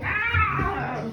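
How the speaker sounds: high biting-horse cry; angry horse snarl. Acted. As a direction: screech like a horse lunging to bite. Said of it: "trying to bite folk".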